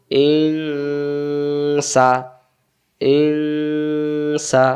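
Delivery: ইং held in a long hum before সা; hidden nun. Shown as a demonstration. Teacher reading nun sakin before sin, long nasal ইং. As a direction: stretch the nasal ng of ইং, then open to সা. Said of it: "গোপন করে পড়তে হয়".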